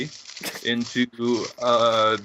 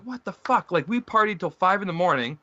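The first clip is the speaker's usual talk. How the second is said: indignant outburst; 6 semitones higher than usual. Retold or imitated. Retold outrage at rideshare driver.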